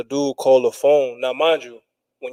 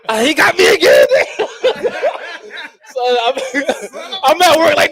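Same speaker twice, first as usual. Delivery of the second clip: laughing shout; 12 semitones higher than usual. Real, not acted. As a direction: crack up in disbelief as you say it. Laughs, disbelief at repeat insult.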